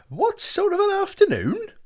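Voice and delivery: very high, loud disbelieving cry; excited cry. Acted. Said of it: "cried Brian".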